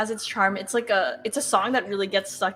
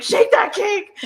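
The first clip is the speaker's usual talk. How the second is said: high, loud holler through laughter; re-enacted laughing yell; 11 semitones higher, 10 dB louder, much slower than usual. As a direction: yell it gleefully across the house. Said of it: "because I yell it at him".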